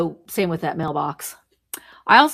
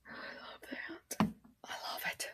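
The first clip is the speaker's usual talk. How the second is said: whispered, fond and hushed; soft adoring whisper; breathy whisper; 22 dB quieter than usual. Real, not acted. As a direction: hush it like a secret treasure. Fond over a book.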